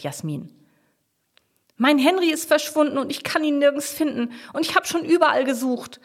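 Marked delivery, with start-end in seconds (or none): 1.8-6.0 high-pitched, plaintive distress; upset owner pleading over a lost pet; very sad. A narrator's reading. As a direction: lament it, pitch held high. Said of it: "klingt ganz traurig".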